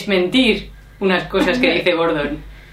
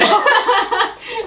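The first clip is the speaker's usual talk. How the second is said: high, giggly outburst; laughter; 13 semitones higher than usual. Real, not acted. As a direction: burst out laughing mid-story. Laughing at own retelling. (carcajada aguda)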